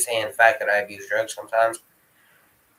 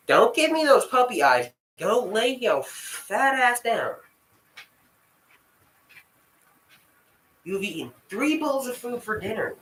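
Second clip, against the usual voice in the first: exasperated scolding; 10 semitones higher than usual. Real, not acted. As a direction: scold the begging dog, stretching the words. Scolding begging dog.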